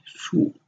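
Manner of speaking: "shu" held level; first tone shu. Shown as a demonstration. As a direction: keep "shu" flat. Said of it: "the tone should be a flat tone".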